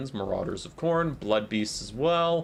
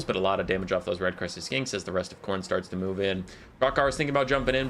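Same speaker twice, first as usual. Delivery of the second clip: ordinary talk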